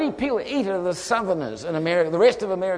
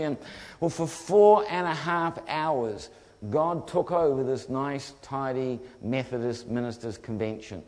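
ordinary talk

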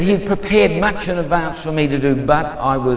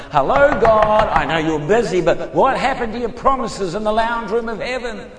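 raised, higher-pitched protest; railing at heaven; 5 semitones higher than usual. Retold or imitated. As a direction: call out to God in exasperated complaint. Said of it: "I would rail and cry to the heavens".

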